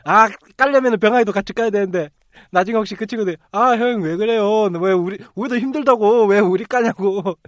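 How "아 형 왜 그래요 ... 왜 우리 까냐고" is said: loud, high, drawn-out complaint; raised-voice mock whine of objection; mock protest. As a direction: imitate a friend protesting, dragging out the words. Voices friends protesting at being criticized.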